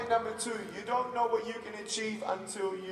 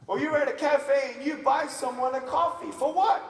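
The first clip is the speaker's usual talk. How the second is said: usual speaking voice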